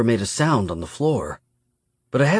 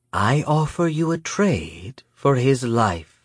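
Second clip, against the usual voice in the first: slow, measured villain's offer; menacing Miyamune; 3 semitones higher, slower than usual. A narrator's reading. Predator offers a trade for a hostage, measured menace. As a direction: lay out the bargain deliberately.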